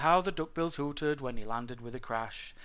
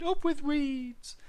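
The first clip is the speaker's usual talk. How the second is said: high duckbill dinosaur hoot; happy piping cheer; 13 semitones higher, 5 dB louder, much slower than usual. A narrator's reading. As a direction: hoot it out with delight, far up in pitch. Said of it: "they hooted".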